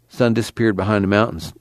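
plain reading voice

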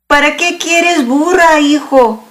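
a hint of a puzzled wife, pitched well up; wife's voice.

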